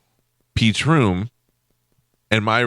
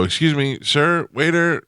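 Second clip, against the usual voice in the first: polite call; 7 semitones higher than usual. Retold or imitated. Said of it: "and you go".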